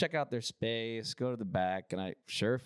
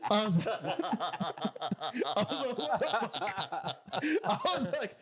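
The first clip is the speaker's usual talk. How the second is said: words broken by real laughter; laughing hard; 8 semitones higher, much slower than usual. Real, not acted. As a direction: laugh through the words, slowed. Laughing at the knockout news.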